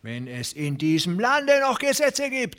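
a farmer pleading for law; hoffender Rancher. A narrator's reading. Rancher voiced. (deutlich höher, hoffend)

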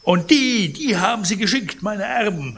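high, loud indignant cry; outraged old collector; indignant outcry. Acted. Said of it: "rief Pons empört".

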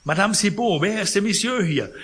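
high, loud cry to the concierge; alarmed call. Acted. Sick man calls to Madame Cibot about the stranger. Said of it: "rief er".

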